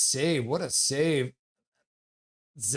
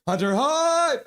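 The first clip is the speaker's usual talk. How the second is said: rowdy fan yell; acted hype cheer; 12 semitones higher, 4 dB louder, slower than usual. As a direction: chant bursting into a high held shout. Reading chat's chant aloud.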